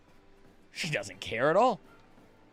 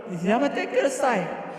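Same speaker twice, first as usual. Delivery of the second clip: high, slow anime plea; 11 semitones higher, 5 dB louder, slower than usual. Pretend. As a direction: whine it like an anime girl. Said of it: "Just a pure vomit".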